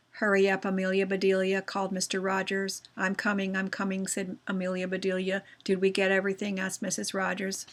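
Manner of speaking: monotone read-aloud, every line on the same note; expressionless reading. Shown as a demonstration. Teacher shows pupils bad reading without expression, monotone. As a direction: drone through the dialogue with no expression. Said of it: "That sounds pretty bad".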